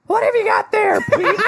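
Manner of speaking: high goblin rasp, half laughing; female goblin voice. Shown as a demonstration. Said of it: "Gravelly, pitch change".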